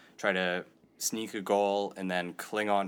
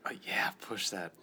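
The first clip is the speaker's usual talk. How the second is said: whispered excited studio voice; breathy eager whisper about the knobs; overexcited recording artist; 3 semitones higher than usual. Pretend. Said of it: "character of a recording artist".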